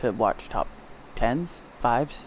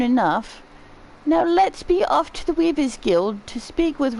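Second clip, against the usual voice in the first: higher-pitched, caring character voice; kindly storybook-style character, hopeful and reassuring; kindly game character; 12 semitones higher, 3 dB louder than usual. Acted. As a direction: act a fond, encouraging guardian, pitched up.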